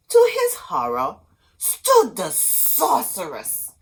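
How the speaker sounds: dramatic reveal. A narrator's reading. Narrator revealing the sorceress, horrified. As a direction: reveal the witch with a shock.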